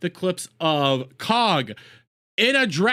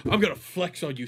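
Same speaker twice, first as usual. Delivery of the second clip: a lower, fast dudebro voice; mock bro; 7 semitones lower, faster than usual. Streamer mocking flexing by playing a bragging bro, lower voice. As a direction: brag to the boys, lower and quicker. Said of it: "You don't see me going around going like".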